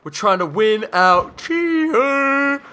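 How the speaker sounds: exasperated drawn-out cry; mock exasperated yell. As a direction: stretch it out like a fed-up whine at the campers. Complaining at camping enemies.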